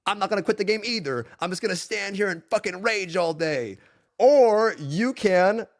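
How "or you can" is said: very slow, building to a shout; slow build to a loud payoff; drawn out. Real, not acted. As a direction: stretch it, then shout the answer. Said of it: "dramatic pause before the advice".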